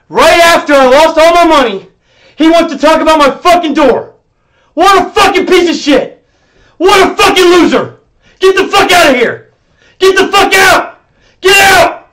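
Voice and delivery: high, loud yelling to throw him out; furious shouting. Real, not acted. Streamer throwing Twitch staffer out of chat, furious shout. Raging before banning the staffer.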